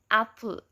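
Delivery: apple said slowly, first A strong, end a weak schwa; stressed first syllable. Shown as a demonstration. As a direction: give apple with a clear first A. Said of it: "the a sound ... is the unstressed sound".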